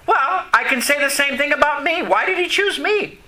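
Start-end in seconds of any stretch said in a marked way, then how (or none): none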